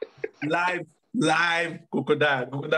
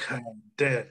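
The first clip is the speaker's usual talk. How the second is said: ordinary delivery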